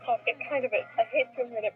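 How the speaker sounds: ordinary talk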